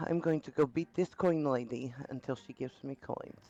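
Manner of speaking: usual speaking voice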